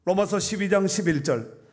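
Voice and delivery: loud, high scripture proclamation; full-force preaching. Real, not acted. Preacher quoting the master rebuking his lazy servant, full-force preaching. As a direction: thunder out the verse from the pulpit.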